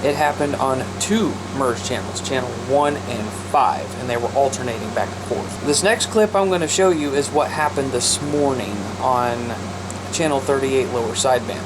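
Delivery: ordinary delivery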